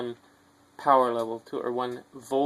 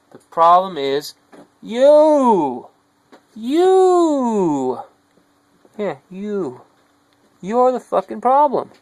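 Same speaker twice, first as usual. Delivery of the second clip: much higher and louder, pointing at them; accusing shout; 8 semitones higher, 11 dB louder, much slower than usual. Real, not acted. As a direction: jab the word you at the listener. Accusing the listener directly.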